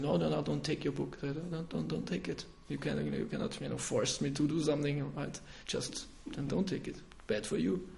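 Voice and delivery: imitating the upset woman's soft rebuff; upset refusal. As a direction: hand back the refusal quietly, offended. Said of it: "she was really upset".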